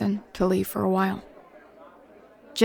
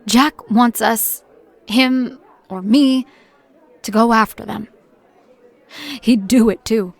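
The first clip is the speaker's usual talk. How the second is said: pained emphasis; 3 semitones higher, 4 dB louder than usual. Acted. Anguish about Jack.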